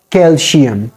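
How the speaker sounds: "calcium" with a sh sound for the c; "calcium" said like "calshium"; mispronounced calcium. Shown as a demonstration. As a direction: say "calcium" as kel-shee-um. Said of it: "not calcium".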